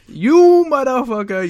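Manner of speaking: loud, high, slow mock proclamation; hammed-up fantasy cursing; mystical D&D character. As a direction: draw the insult out grandly. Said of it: "whatever mystical character".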